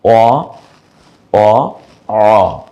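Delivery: drawn-out অ, said clearly; letter sound demo. Shown as a demonstration. Teacher showing students the first অ. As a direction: model the অ sound slowly. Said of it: "প্রথমটা আমরা বলবো হচ্ছে".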